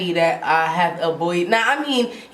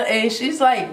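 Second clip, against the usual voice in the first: ordinary talk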